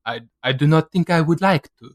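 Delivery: put-on a vague, botched French accent; trying for a French accent and missing; failed French accent. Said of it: "that was not a French accent".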